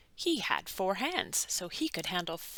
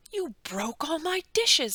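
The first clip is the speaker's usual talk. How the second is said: upset cry; 8 semitones higher, 7 dB louder than usual. A narrator's reading. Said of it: "someone cried".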